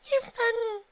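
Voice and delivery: put-on very high child voice; amused little filly. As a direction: pipe up like a delighted child. Giggling filly speaks.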